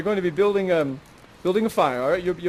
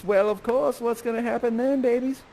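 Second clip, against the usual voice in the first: usual speaking voice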